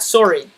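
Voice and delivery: SORRY as "sore-ee", rounded first vowel; Canadian sorry. Shown as a demonstration. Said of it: "Like that".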